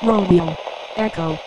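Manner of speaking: high-pitched, processed radio voice spelling code words; intercepted code transmission. Acted. Decoded code from the submarines, high processed radio voice. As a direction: call out each code word like an intercepted signal.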